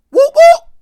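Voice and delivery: high, loud whooping siren sound; police siren imitation. Mimicking the police siren as the cops pull up on the drunk teens. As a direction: shout it like a cop car siren.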